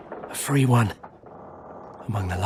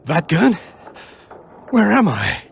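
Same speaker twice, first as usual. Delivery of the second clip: higher and louder, startled and lost; confused waking; 9 semitones higher, 8 dB louder than usual. Acted. Wounded elf waking on a prison barge, high and confused. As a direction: come to with a jolt, disoriented.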